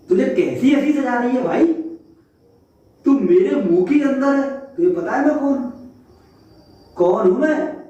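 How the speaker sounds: high, indignant lion demanding answers; haughty lion's outraged questions; angry lion. Acted. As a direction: roar at the cheeky monkey from above. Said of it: "मैं जंगल का राजा हूं".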